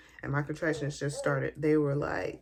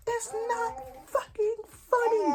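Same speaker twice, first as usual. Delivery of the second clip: very high, slow furious line; snapping at her laughing husband, very high and slow; angry; slower than usual. Retold or imitated. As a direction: snap at him hard.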